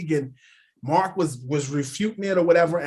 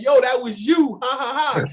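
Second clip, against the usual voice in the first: amused realization; 8 semitones higher, 4 dB louder than usual. Retold or imitated. Redick realizing, laughing.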